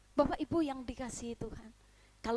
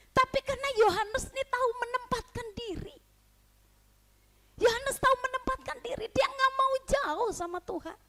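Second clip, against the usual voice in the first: higher preaching push; emphatic preaching; 10 semitones higher, 4 dB louder than usual. Real, not acted. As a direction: lift and stress the conclusion. (penekanan khotbah)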